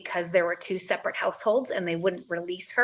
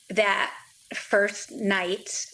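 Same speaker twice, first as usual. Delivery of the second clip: ordinary delivery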